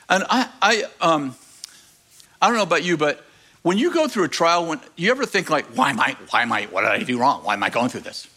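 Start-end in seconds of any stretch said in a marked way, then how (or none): 5.7-8.3 inner complaint. Retold or imitated. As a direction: mutter the doubt to yourself. Voicing inner complaint in trial.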